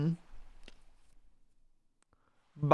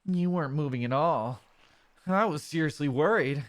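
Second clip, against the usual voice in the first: relieved partner Mudkip; 9 semitones higher than usual. Acted. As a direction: show you were worried, then ask gently.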